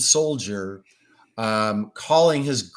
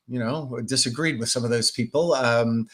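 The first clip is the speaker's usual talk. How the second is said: ordinary talk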